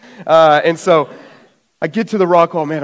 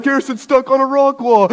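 high-pitched imitation of brother; brother calling out; 10 semitones higher than usual. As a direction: announce it in mock dismay, pitched up. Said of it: "my brother's like".

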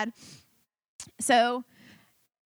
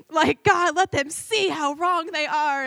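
raised, drawn-out bitter plea; indignant prayer; 6 semitones higher, 4 dB louder, slower than usual. Retold or imitated. Praying against those who hurt her, high indignant plea. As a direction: plead loudly to be proven right. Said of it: "yeah, I really did pray that".

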